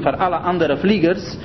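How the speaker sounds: usual speaking voice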